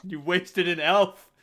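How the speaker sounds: high, loud exasperated yell; shouted scolding. Acted. Sycophants yelling at the debutante, high and loud. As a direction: scold her for wasting the elf.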